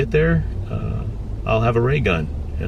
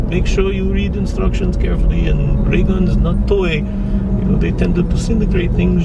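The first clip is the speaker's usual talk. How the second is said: high, broken-English Croatian dealer voice; Croatian accent impression; 6 semitones higher than usual. Vlogger imitating the Croatian seller warning about the ray gun, Croatian accent. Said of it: "And he's like".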